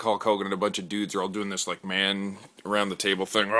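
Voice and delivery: normal talking voice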